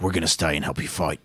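low East London tough guy; East London accent. Acted. Podcaster voicing Hunter for a friend, East London accent. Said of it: "I don't know why I gave him this accent".

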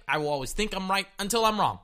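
usual speaking voice

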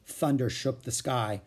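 ordinary delivery

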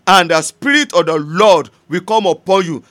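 preacher thundering the verse; forceful preaching. Acted. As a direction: proclaim it to the congregation. Quotes scripture again loudly.

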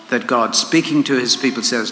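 usual speaking voice

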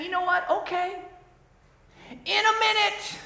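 mock excuse. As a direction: echo the lazy kid. Mimicking excuses to God.

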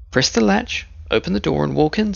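high, lightly voiced old woman; a hint of a frail granny; grandmother voice. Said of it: "said the grandmother".

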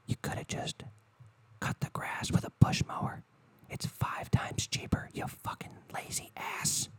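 hushed unvoiced whisper, slowing down; mock whisper. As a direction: whisper the insult like a secret. Whispered jab at lazy neighbors.

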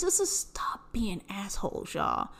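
hushed, breathless whisper. Real, not acted. Whispering a plea to freeloading friends. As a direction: drop to a whisper to plead.

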